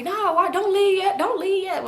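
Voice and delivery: high-pitched mock pleading; pleading men. Men beg the departing girls to stay, imitated pleading. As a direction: imitate people begging you to stay.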